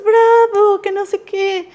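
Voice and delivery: high, loud cheering bravo; mock cheer. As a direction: cheer like the whole class. Classmates cheering, retold.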